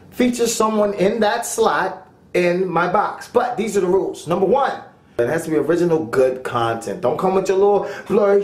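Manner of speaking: usual speaking voice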